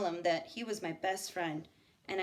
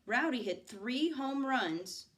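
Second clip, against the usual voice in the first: usual speaking voice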